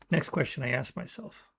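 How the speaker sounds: ordinary delivery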